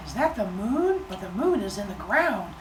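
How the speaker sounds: put-on soft, high little-child voice; wondering baby. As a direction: puzzle it out like a tiny kid.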